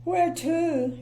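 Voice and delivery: high, sniffy and glum; sniffy miserable voice. Acted. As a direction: sniff it out disdainfully. Mister Miserable asks.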